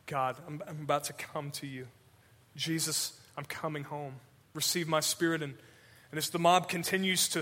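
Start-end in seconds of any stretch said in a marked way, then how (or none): 0.1-5.4 soft, tender, going home; whisper-close surrender to God; softly voiced dying prayer. Stephen's dying words voiced.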